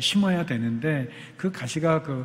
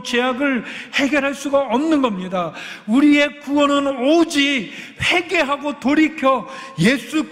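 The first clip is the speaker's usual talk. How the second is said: higher, louder fervent preaching; full-force preaching; 8 semitones higher, 7 dB louder than usual. Acted. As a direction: preach it out to the congregation. Preaching climax of sermon.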